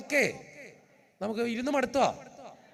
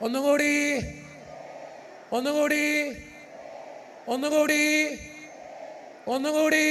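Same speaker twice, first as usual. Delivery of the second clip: preacher shouting once more; 6 semitones higher than usual. Real, not acted. Priest urges crowd again.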